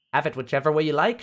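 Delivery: high squeaky little voice, shouting; squeaky creature shout. A narrator's reading. Gnome shouting at the traveller, squeaky voice. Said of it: "shouts the creature in a squeaky voice".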